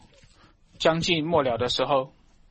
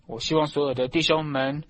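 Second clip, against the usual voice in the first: normal talking voice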